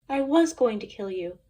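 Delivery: ordinary delivery, nothing marked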